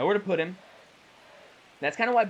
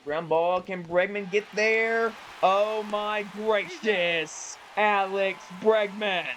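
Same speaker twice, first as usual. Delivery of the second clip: excited call; 6 semitones higher, much slower than usual. Real, not acted. Tense play-by-play call.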